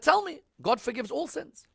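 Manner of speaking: high, emphatic quoted challenge; retold insistent question. Voices Prophet insisting on forgiveness.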